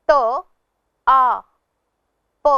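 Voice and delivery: त आ प, with the आ loud and clear; spelled sound by sound. Shown as a demonstration. Teacher to pupils, sounding out ताप. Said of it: "उनके स्वरों पर ध्यान देंगे".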